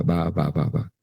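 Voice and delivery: ba, ba, ba said softly as one syllable; ba repeated as a gentle demo. Shown as a demonstration. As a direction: model the syllable ba for them. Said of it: "terminas diciendo la sílaba".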